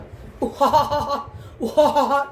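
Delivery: high cackling bird laugh in bursts; kookaburra call demo. Shown as a demonstration. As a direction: imitate how a kookaburra shouts. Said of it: "That's how he shouts".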